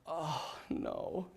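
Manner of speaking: very low, soft dismay; hushed, dropping realization; sinking dread. Retold or imitated. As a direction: let it sink in, almost under your breath. Hears his own name drawn.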